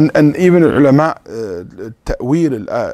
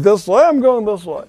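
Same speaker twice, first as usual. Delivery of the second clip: higher, defiant auntie voice doing as she likes; stubborn auntie; 8 semitones higher than usual. Retold or imitated. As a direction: mimic an auntie who ignores everyone.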